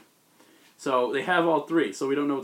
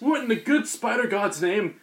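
mock oath; 8 semitones higher, 4 dB louder than usual. Echoes the joke exclamation.

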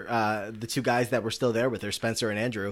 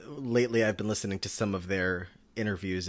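ordinary delivery

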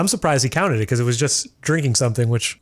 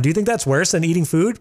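normal talking voice

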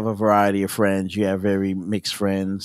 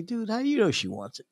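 high, quick, soft protest; retold incredulous challenge; 9 semitones higher, 9 dB quieter, faster than usual. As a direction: question a buddy's claim in a light, high rush.

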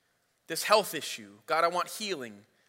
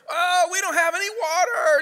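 high, loud hammed-up wailing complaint; mock whining cry; 11 semitones higher, 6 dB louder than usual. Preacher voicing thirsty Israelites wailing, high loud mock cry. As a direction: wail the complaint like a crybaby.

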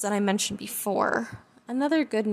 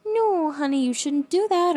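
higher, louder sing-song scolding; sing-songy baby talk; 6 semitones higher, 6 dB louder than usual. Shown as a demonstration. Owner baby-talks a biting puppy, sing-song and higher. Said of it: "avoid sing-songy things like".

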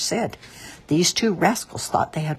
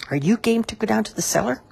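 higher, quick question; eager question; 7 semitones higher, faster than usual. A narrator's reading. Mister Whiskers asks Maggie about the cellar, eagerly. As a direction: ask it fast and lifted, like a dare.

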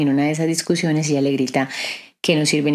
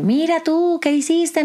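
mock rude scolding, pitched high; rude person; 13 semitones higher, slower than usual. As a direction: play the rude colleague snapping at her. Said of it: "un ejemplo". (imitando a alguien grosero que regaña, agudo)

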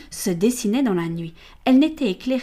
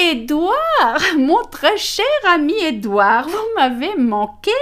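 higher, louder, cooing flirt; flirtatious lady; 7 semitones higher, 7 dB louder, slower than usual. Acted. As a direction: lure him with sugary charm. Amelia flirts with Callaghan. (dame charmeuse, aiguë et roucoulante)

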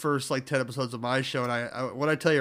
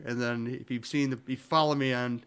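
normal talking voice

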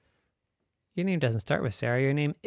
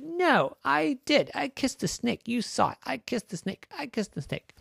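higher, stubborn protest; insistent girl; 12 semitones higher than usual. Acted. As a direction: insist you did it. Sarah insisting.

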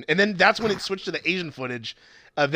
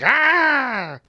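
1 mock cry; 11 semitones higher, 5 dB louder than usual. Mimics actress pedaling bicycle.

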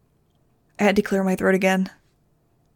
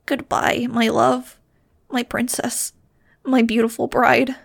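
sobbing; 4 semitones higher, slower than usual. A narrator's reading. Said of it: "he sobbed".

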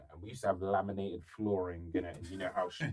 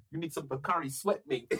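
imitating Chris; 7 semitones higher than usual. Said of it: "he's like".

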